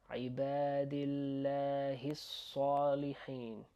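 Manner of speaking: measured model phrase "عبادي الله الصالحين", doubled letters lengthened; joined-up recitation. Shown as a demonstration. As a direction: link "الله" into "الصالحين", long vowels held. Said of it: "how are we gonna put all of this together?".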